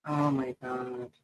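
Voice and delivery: soft, slow oh my God; hushed dismay. Real, not acted. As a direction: breathe it out in dismay. Reading flood news.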